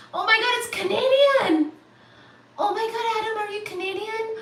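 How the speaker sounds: high-pitched astonishment; amazed. Real, not acted. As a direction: exclaim it high, as a sudden discovery. Amazed the donor is Canadian.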